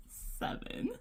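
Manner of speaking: breathy laugh through the word. Real, not acted. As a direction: giggle the word out. Laughs at her own counting slip.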